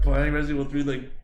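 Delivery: ordinary delivery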